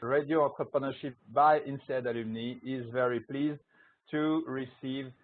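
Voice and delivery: usual speaking voice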